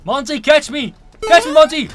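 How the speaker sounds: loud, high taunting call; excited shout. Real, not acted. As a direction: goad the monster, shouting. Taunts monster after losing.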